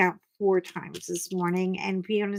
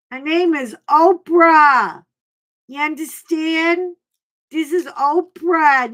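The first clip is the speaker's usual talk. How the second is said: gruff Chicago guy; 6 semitones higher, 6 dB louder than usual. Retold or imitated. Chicago shop owner scolding her over Oprah's name, mock indignant. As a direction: imitate a cigar guy loudly telling someone off. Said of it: "he comes out with a cigar and he goes".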